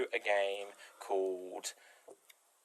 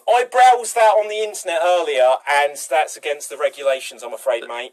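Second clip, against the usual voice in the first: cite the regulations smugly; officious clerk; 2 semitones higher, 8 dB louder than usual. Pretend. Improv official refusing.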